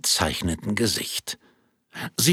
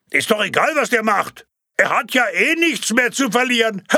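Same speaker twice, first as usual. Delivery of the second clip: mocking heckler; 13 semitones higher, 4 dB louder than usual. Another heckle amid laughter.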